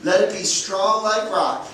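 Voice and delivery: put-on slow earnest prayer to the children's Jesus. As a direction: lead the little ones in a heartfelt prayer.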